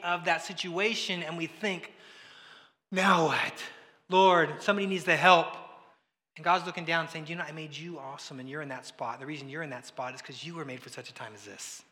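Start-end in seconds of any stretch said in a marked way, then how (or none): 2.9-5.6 desperate cry. Retold or imitated. Panicked prayer in a hard spot. 7.3-11.8 gentle God voice. Retold or imitated. God speaks gently to the worrier.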